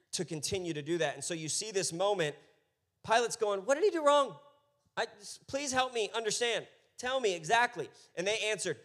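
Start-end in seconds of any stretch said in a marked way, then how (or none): 3.6-7.9 much higher, pressing for an answer; pleading Pilate. Retold or imitated. Pilate questioning the accusers, high and insistent. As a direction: Pilate begging the crowd to explain.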